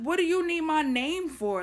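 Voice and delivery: indignant retort. Retold or imitated. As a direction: challenge the officer in a retold protest. Indignant retort to officers, retold.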